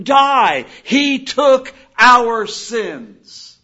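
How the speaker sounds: high, loud, ringing sermon cry; impassioned preaching. Acted. Preacher crying out to the congregation about the cross, impassioned preaching. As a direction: proclaim it at full force, stretching the key words.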